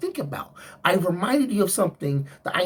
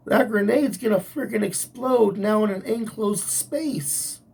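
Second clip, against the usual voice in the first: usual speaking voice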